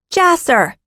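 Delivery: put-on high calling girl's voice; older sister calling the boy home; girl's cry. A narrator's reading. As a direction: call him from afar. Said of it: "a girl's voice cried".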